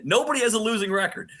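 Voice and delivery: high, fast, mock-exasperated retort; lightly acted exasperation, high and quick. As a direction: pitch it way up like you can't believe you have to say it. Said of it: "I'm trying to tell Adrian, like".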